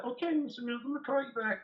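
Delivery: high, sweet phone voice; imitating the girlfriend. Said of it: "She said".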